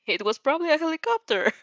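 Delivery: high, fast nervous blurt; hasty cry. Acted. Covering a lie to friends, quick high blurt. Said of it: "you cried".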